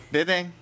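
loud high "ででん" jingle; quiz jingle sound. Pretend. Quiz show sound effect voice. (クイズ番組の効果音風に)

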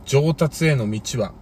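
put-on slow low wise old coach; Anzai-sensei impression. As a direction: speak like a calm old mentor. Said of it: "安西先生。".

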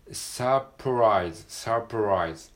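サプライズ with a curled English R on ラ; teacher demonstrating the R sound; English R in surprise. Shown as a demonstration. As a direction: stress ラ in サプライズ as a rolled-back English R. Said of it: "ラの部分がRですね".

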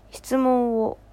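ordinary talk, nothing marked

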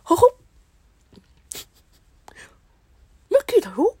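high squeaky falsetto greeting; Mickey Mouse impression. Streamer doing Mickey again, high falsetto. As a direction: chirp it like the cartoon mouse. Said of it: "名前言うてもうた".

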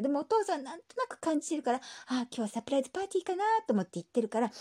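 ordinary talk, nothing marked

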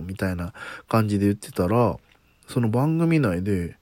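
normal talking voice

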